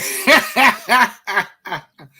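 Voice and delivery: loud high burst of laughter; a real delighted laugh. Real, not acted. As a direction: crack up at the screen. Laughing at homeboys on screen.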